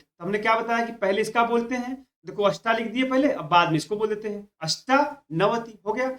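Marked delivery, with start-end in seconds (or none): none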